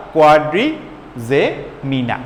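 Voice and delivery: কোয়াড্রিজেমিনা drawn out syllable by syllable; very slow pronunciation. Shown as a demonstration. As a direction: stretch কোয়াড্রিজেমিনা very slowly for the class. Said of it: "এই দেখো, corpora". (খুব ধীরে টেনে উচ্চারণ)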